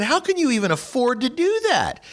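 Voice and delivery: high-pitched retold disbelief; incredulous question. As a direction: ask it in high, astonished amazement. Retold shocked question to the smoker.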